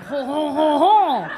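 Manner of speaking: high, loud comic French-guy laugh; Keenan. Retold or imitated. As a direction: belt out a hammed-up 'ho ho ho'. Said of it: "I've watched Keenan do".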